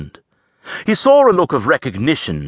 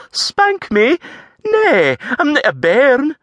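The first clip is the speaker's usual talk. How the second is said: much higher, louder protesting lass; indignant Scots lass; 4 dB louder, slower than usual. A narrator's reading. Avril protesting the threat, indignant and high. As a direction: bristle at being called a child.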